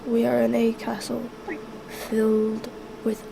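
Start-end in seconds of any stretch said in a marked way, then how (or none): none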